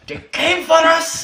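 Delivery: high hammed-up German voice; German accent. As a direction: overdo a German storyteller. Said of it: "I wish I knew how to do a German accent".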